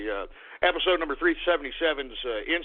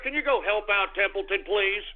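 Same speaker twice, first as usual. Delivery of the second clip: ordinary delivery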